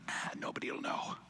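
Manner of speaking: secretive breathy whisper to oneself. Retold or imitated. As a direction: hush it like a guilty secret. Inner thought voiced in temptation.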